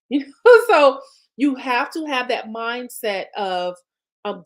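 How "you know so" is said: a burst of laughter going into the words; laughing. Real, not acted. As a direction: laugh it out, loud and high. Laughs at her own example.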